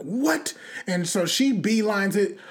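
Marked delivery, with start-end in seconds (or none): 0.0-0.5 higher, abrupt incredulous question; mock shocked reaction. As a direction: play her sudden indignant surprise. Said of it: "turns on and is like".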